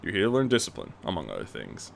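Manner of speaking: put-on low, soft stern old teacher; low quiet old man's rebuke; chiding. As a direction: chide him quietly from deep down. Bruce lectures Terry.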